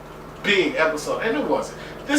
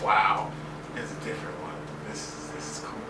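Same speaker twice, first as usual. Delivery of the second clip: hushed awe; 7 semitones lower, 8 dB quieter than usual. Retold or imitated. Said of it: "and I'm like".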